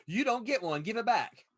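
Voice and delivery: mock scolding. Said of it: "I'm like".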